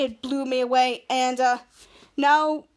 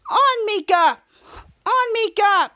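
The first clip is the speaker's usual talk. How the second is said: higher, louder rallying cry; calling out; 6 semitones higher, 5 dB louder, slower than usual. Retold or imitated. The sleigh crew urges the sleigh on, calling loudly. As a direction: shout it like driving reindeer. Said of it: "It was like".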